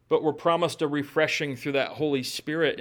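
normal talking voice

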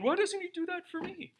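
high-pitched mock whine; comic, high grumble of complaint; envious townsfolk. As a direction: whine it as a left-out bystander. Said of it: "imagine all the other townsfolk going".

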